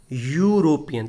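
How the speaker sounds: यूरोपियन drawn out from an initial "yu"; pronunciation demo. Shown as a demonstration. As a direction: stretch the opening y sound of यूरोपियन. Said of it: "या आ रहा है". (यूरोपियन में शुरू का 'या' साफ़ सुनाते हुए)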